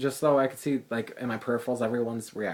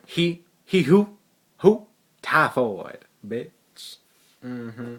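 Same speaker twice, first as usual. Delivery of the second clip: louder, slow sassy drag-queen attitude; sassy drag persona; 4 dB louder, much slower than usual. Pretend. Drag queen Typhoid correcting a classmate, sassy and louder. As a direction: snap back in character. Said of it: "Why am I talking so ratchet?".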